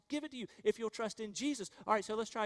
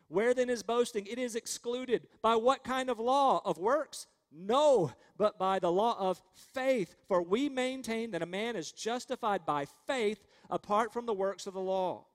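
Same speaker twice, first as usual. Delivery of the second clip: loud, raised, declamatory preaching; forceful scripture reading; 3 semitones higher, 5 dB louder than usual. Acted. Preacher reading Romans to the congregation, forceful and ringing. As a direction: proclaim the verse, hitting the key words hard.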